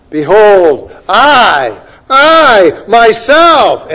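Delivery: loud, slow, high stress on I myself; emphatic. Acted. Preacher stressing God's words to congregation, emphatic. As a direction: drive home I myself with a slow loud cry. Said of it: "emphatic here".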